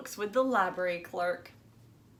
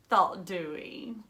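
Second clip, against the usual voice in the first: usual speaking voice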